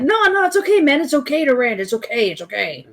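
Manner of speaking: high, falsely soothing voice, fading down; mock soothing dismissal. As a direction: brush it off like a smug enabler. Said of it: "the other kind of people will just like".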